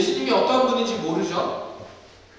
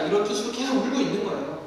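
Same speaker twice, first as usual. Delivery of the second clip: ordinary delivery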